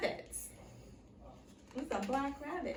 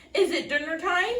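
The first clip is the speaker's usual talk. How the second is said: higher, louder call across the field; 7 semitones higher, 8 dB louder than usual. A narrator's reading. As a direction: call out from a distance. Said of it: "he called".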